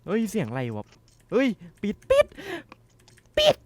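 real jump-scare shriek; terrified scream. Real, not acted. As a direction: scream to shut it now. Jump scare, slams door.

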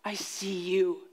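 soft, slow hissed warning; quiet, slow hissing threat. Retold or imitated. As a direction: hiss it at the cat. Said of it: "I hissed at it".

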